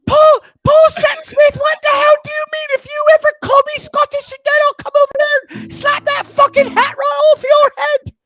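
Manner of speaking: shrill, high, loud British mom scolding; mom impression. Threatening Paul over being called Scottish, high loud British mum. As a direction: bellow it like an angry British mum. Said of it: "what accent are you doing when you do your mom".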